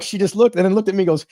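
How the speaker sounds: normal talking voice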